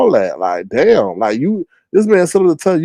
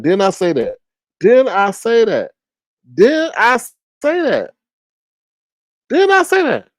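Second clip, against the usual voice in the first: crowing, climbing higher with each repeat; triumphant I-told-you-so; 9 semitones higher than usual. Real, not acted. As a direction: gloat in an ever higher squeal. Crowing that the prediction came true.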